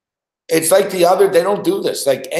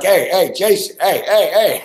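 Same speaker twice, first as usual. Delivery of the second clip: mock urgent repeated calls, slightly raised; urgent calling out; 4 semitones higher, 3 dB louder than usual. Host calling Jason to cut off caller, urgent. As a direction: imitate trying to get someone's attention fast.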